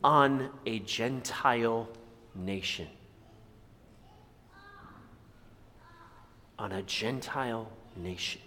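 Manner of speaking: low, soft, with long pauses; hushed appeal. Real, not acted. Preacher asking the congregation why they hold back, hushed. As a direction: let it sink in quietly and low.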